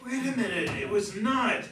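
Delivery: high, loud, laughing protest; indignant objection. Acted. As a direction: cut in, half-amused and offended. Acted quarrel, husband objects.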